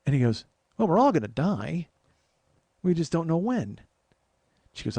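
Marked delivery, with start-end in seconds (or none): 0.8-3.8 breezy joking reassurance. Retold or imitated. Dan jokes about dying.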